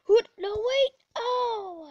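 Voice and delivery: high, loud cry of protest; alarmed shout. Real, not acted. Gamer caught out mid-mission, alarmed shout. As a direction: yelp it in sudden alarm. Sudden alarm during play.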